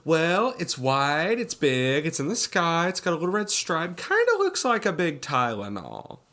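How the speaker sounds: flamboyant impression, high and loud; Jacobs. Imitating Jacobs describing the plane to the press, flamboyant. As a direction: stretch the opening, then list it off campily. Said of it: "And Jacobs says".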